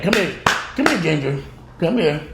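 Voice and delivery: high-pitched repeated summons; calling the dog. Real, not acted. Owner summons Ginger mid-video, high calling voice.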